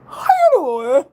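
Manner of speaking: casual, unprofessional greeting. Shown as a demonstration. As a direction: exaggerate a loose, too-casual hello. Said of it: "You is not fitting to talk like that".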